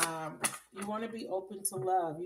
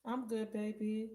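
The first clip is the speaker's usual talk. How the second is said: usual speaking voice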